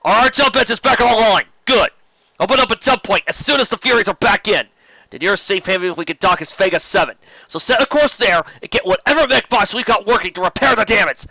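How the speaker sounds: high, loud captain's commands over the din; shouting orders. Acted. Captain commanding the crew after battle, loud shouting. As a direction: bellow the orders so the whole bridge hears.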